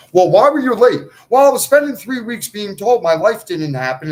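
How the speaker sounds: loud, heated outburst; angry rant. Real, not acted. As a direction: fire it back at the court. Imitated court exchange, angry.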